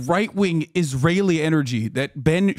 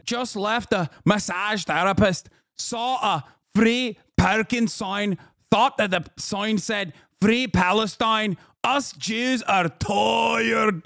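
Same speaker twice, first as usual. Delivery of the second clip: slow, drawn-out mock-Scottish, "tired" stretched; Scottish accent impression; 3 semitones higher, slower than usual. Mocking Eve Fartlow's parking sign post, Scottish accent. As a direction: whine it in her Scottish accent. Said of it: "that former banger from Eve Fartlow where she said".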